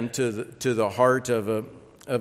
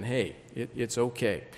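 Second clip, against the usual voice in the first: a soft, tender hushing reassurance; gentle comforting; 7 dB quieter than usual. Retold or imitated. Joseph comforts his brothers, soft tender reassurance. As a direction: soothe frightened brothers softly. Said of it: "I can just imagine him bringing them all in".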